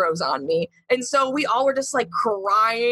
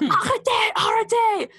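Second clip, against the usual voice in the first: imitated screaming; 11 semitones higher than usual. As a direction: imitate cops yelling in French, pitched well up. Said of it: "screaming at us".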